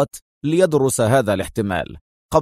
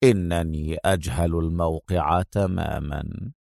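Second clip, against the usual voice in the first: low, soft and slow; unbothered, let it roll out lazily; fully relaxed; 8 semitones lower, 7 dB quieter than usual. Acted. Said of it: "في استرخاء تام". (استرخاء تام)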